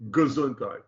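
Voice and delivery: GESUNDHEIT as guh-ZUN-tight; barely-said vowel. Shown as a demonstration. Teacher comparing a shva, GESUNDHEIT with a barely voiced first vowel. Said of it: "just barely saying the sound of that letter".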